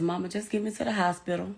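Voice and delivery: hushed voicing of her shot son; quiet plea. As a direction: hold it low and steady like he's fading. Wounded son's quiet plea retold.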